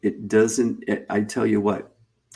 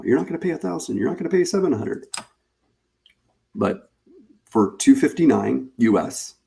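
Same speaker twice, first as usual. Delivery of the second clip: higher, hammed-up TV pitchman; infomercial voice; 4 semitones higher than usual. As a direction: oversell it like a late-night ad. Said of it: "I almost sound like an infomercial now".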